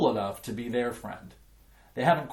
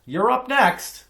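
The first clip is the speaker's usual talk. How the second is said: high, loud and slow across the yard; calling out; 12 semitones higher, 9 dB louder, slower than usual. A narrator's reading. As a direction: shout that it's his turn now. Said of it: "Emilio calls out from the tetherball court".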